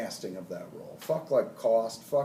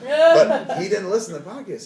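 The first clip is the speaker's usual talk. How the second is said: higher and louder, laughing through it; 10 semitones higher, 12 dB louder than usual. Real, not acted. Laughing while telling the audience Depp never heard the podcast. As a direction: still laughing as he makes the point.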